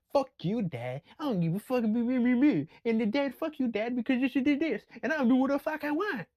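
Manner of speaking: high, bratty yelling daughter; mock a furious teenage girl; defiant teen daughter. Daughter yelling at her dad, high bratty mock. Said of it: "a daughter will fucking tell their dad".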